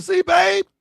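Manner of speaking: loud, high triumphant "See, babe?"; high loud told-you-so; gloating shout. Retold or imitated. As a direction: crow it at your wife, loud and high. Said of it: "I said".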